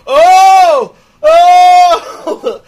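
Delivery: loud, high "oh!" wails; hammed-up horror cries; mock horrified wail. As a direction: imitate a moviegoer wailing in shock. Imitates woman reacting to screen killing.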